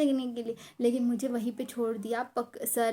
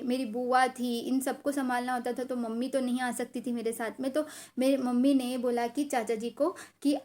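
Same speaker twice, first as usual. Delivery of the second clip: ordinary talk, nothing marked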